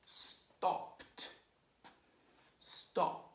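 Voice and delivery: "stopped," as one syllable, stop plus a crisp T; voiceless T ending. Shown as a demonstration. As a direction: release PT at the end of "stopped," with air only. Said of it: "But you pronounce like".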